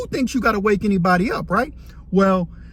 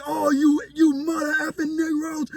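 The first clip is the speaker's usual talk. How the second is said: mock anguished cursing; 7 semitones higher than usual. Cellmate cursing everyone, crying out.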